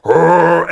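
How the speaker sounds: loud mock grizzly roar; hammed-up loud roar; bear roar. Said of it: "a nine-foot grizzly bear just going".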